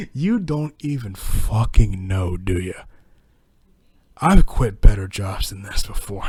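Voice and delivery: low, slow and loud, spitting the words; mock-defiant tirade, slow and low; angry inner rant. Worker ranting silently at his manager about quitting, low and loud.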